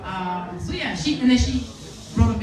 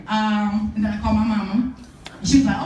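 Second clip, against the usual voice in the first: normal talking voice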